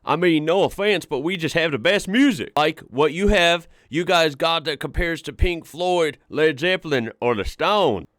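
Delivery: put-on loud, boastful Texan accent. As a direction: brag like a proud Texan. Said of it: "I gotta do my best Texan accent".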